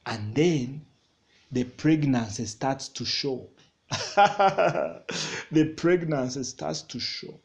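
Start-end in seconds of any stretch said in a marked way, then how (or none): 3.9-5.5 short raised laugh; a real burst of laughter. Real, not acted. Preacher laughs after pregnancy remark.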